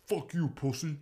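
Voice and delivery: a hint of an angry pitcher mouthing off; Mad Bum impression. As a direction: sneer it like a pitcher swearing at the ump. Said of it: "solid impression of Mad Bum".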